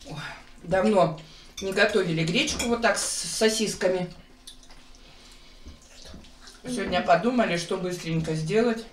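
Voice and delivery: chewing while talking; eating at the table; mouth full. Real, not acted. Seen 0: Woman at left eats throughout, spoon to mouth around 3-4s.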